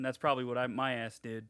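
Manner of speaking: ordinary delivery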